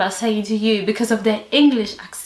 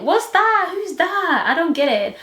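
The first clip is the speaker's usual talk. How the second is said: high, bewildered questions; mock total confusion; baffled; 8 semitones higher than usual. Cashier baffled by the word loo, high bewildered questions. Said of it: "And I'm like".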